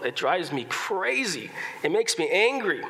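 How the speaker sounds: ordinary talk, nothing marked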